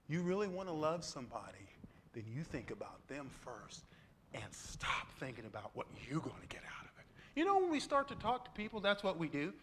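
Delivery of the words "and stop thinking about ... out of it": soft, hushed whisper. Real, not acted. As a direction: whisper it to the congregation. Soft aside in sermon.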